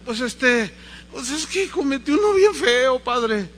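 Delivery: high, hesitant confession; acted sheepish kid; guilty little boy. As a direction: stall as a child dreading to confess. Scared child confessing to priest.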